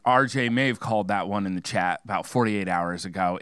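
ordinary talk

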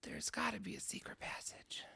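hushed, voiceless whisper. Pretend. Co-player floats a secret passage theory, whispering.

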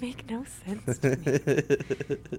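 slow, drawn-out deadpan mockery; mock baffled mimicry. Host teasing co-host baffled by relationships, slow mock bafflement. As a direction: stretch the words out in mocking imitation.

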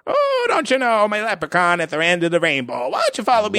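mock leprechaun-ish Irish innkeeper; jolly Irish accent. Keeper leading the couple to their room, hammy Irish accent. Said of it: "Irish fucking name".

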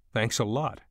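plain reading voice

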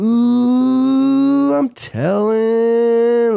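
put-on high, slow, drawn-out childish tattle; tattletale kid. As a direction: whine it like a snitching kid.

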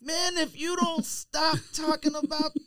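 high, slow mock exasperation; drawn-out fed-up whine; hammed-up plea to stop; exasperated. Real, not acted. Mock exasperation at supply chain talk.

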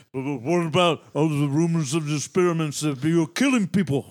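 garbled mumbling caricature; mock marble-mouthed mumble; marble-mouth impression. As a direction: run the words into mush, imitating a mumbling actor. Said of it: "suffers from chronic marble mouth".

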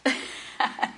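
breathy whispered laugh. Real, not acted. Shocked laugh at confession.

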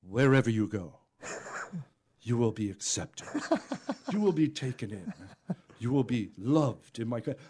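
a touch of a grave elder's benediction; solemn promise. Retold or imitated. Stranger promising the juggler welcome in his country, low and solemn. Man grabs him again, blesses.

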